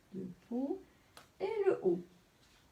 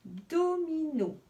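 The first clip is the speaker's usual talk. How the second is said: "domino" slow and higher, syllables evenly spaced; teacher sounding out "domino"; read by syllables; 5 semitones higher, slower than usual. Shown as a demonstration. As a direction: read back "domino" slowly, every syllable clear. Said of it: "Donc là, j'ai écrit".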